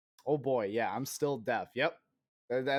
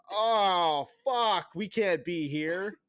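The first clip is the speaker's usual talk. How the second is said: higher, louder, slowed panicked little germ; ear infection voice; 6 semitones higher, 5 dB louder, much slower than usual. Pretend. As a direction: play the infection fleeing the noise in alarm. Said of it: "and go".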